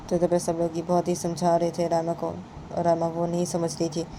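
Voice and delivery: ordinary delivery, nothing marked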